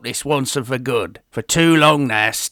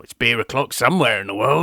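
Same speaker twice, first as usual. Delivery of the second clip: normal talking voice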